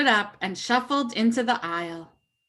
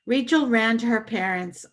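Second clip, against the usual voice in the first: plain reading voice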